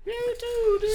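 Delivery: very high falsetto crone; high-pitched echo of the crone's call; fortune teller. Acted. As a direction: squeak it out in a tiny high old-lady voice.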